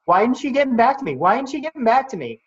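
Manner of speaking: high-pitched, fast, impatient mimicry; mock anxious fretting. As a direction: imitate yourself obsessively fretting over a reply. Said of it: "I'm not checking every two hours going".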